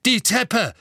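high, loud alarm call; shout. A narrator's reading. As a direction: call it out urgently to the others. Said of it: "rief Dawlish".

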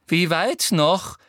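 loud, high, slow angry demand; furious. A narrator's reading. Umbridge behind Harry in the forest, demanding furiously. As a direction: demand it crossly, stretching the words. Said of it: "fragte Umbridge hinter ihm wütend".

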